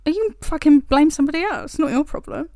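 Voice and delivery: loud, high joking rant; mock blame-shifting. Joking to her co-host, a loud high mock rant. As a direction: jokingly pass the blame onto someone else.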